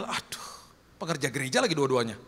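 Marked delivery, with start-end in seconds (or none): none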